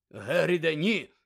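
sharp loud shout; loud command to stop. Acted. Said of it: "крикнув Дамблдор".

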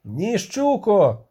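shouted name. A narrator's reading. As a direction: holler the name across the camp. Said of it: "крикнув Бугольд". (протяжний оклик)

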